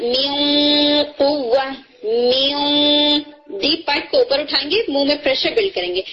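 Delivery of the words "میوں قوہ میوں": میوں قوہ drawn out very slowly with a heavy, full ق; tajweed demo, heavy qaf held slowly; heavy ikhfa demo. Shown as a demonstration. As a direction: build pressure and hold میوں قوہ long. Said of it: "جی، جیسے".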